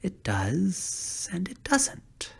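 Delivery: soft murmur. A narrator's reading. Scarecrow murmuring to the little man, soft. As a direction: murmur it half to yourself. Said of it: "murmured the scarecrow".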